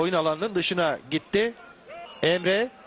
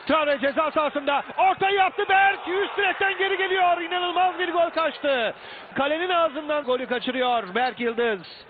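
screaming football commentator; 7 semitones higher than usual. Real, not acted. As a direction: shout the chance off the post. Near miss off the crossbar.

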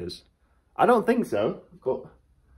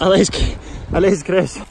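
cheering; 5 semitones higher than usual. Pretend. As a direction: yell like a roadside supporter. Cheering mate like race fans.

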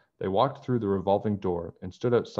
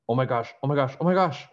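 rising high, louder panicked gasps of oh my gosh; building alarm, each one higher; crying out; 6 semitones higher, 4 dB louder, faster than usual. Acted. Bess spotting the snowman, crying out in alarm. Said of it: "she cried".

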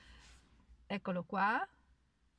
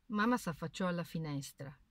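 ordinary delivery, nothing marked

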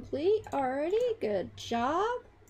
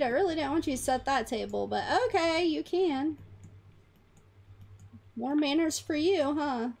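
ordinary talk

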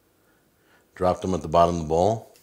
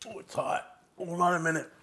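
mouth burning; 10 semitones higher, 7 dB quieter than usual. Real, not acted. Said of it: "I'm burning up right now".

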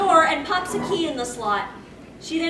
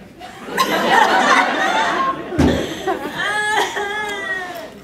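laugh-cry; 4 semitones higher, 5 dB louder than usual. Acted. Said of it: "start crying".

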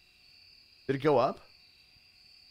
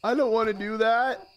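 loud, high-pitched protest; pleading, raised-voice reluctance; upset refusal; 9 semitones higher, 5 dB louder than usual. Real, not acted. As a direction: object in a high, dismayed voice. Refusing the game's order.

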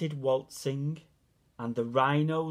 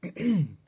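loud throat clear; cricket clearing throat; 5 semitones higher, 5 dB louder than usual. A narrator's reading. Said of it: "coughed a cricket".

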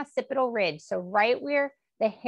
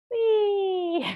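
gleeful whee; 11 semitones higher, 4 dB louder than usual. Real, not acted. Playful stretch, delighted cry.

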